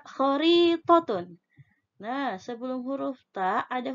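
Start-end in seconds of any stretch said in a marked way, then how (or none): 0.0-1.4 khoritotun said slowly and higher; slow dictation. Shown as a demonstration. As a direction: spell out khoritotun clearly.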